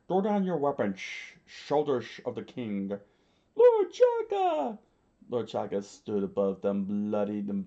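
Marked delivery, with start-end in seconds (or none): 0.1-2.9 higher, slurred, labored command; wounded commander. A narrator's reading. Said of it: "Lord Chaka screamed out". 3.5-4.8 high, loud, stretched-out cry; shocked shout. A narrator's reading.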